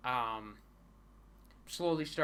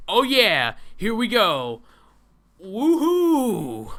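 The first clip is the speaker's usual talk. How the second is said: loud, much higher hammed-up whoop; mock cheer; 8 semitones higher, 16 dB louder, slower than usual. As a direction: cheer like a fake hype man.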